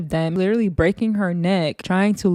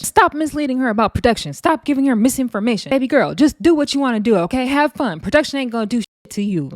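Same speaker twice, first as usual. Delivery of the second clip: higher, louder mock scolding, as if to a child; talking down; 5 semitones higher, 4 dB louder than usual. Rolly scolding her like a little kid, condescending imitation. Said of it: "talking to her like a little kid".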